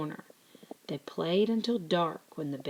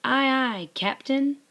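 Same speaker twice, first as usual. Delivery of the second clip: loud, higher salute; saluting; 8 semitones higher, 8 dB louder than usual. A narrator's reading. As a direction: answer the captain. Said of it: "Digger and Tiger saluted".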